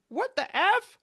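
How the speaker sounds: high, loud, outraged disbelief; the mind reeling at the answer; shocked mind. Retold or imitated. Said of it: "like, going".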